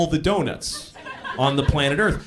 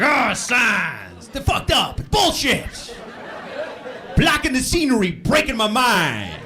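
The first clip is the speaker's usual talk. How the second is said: higher, slow, hammed-up indignant rant; mock outrage; 5 semitones higher, slower than usual. Singer mad at signs, hammed-up mock rant. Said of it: "The guy's like, he gets all mad".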